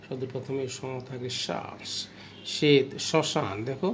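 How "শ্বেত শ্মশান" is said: শ্বেত and শ্মশান said slowly, the শ্ম with a slight nasal touch; teacher's slow demo. Shown as a demonstration. Teacher shows students the nasal শ্ম in শ্মশান, slow and careful. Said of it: "স এর উপরে কিছুটা নাসিক্য অবস্থা আসছে".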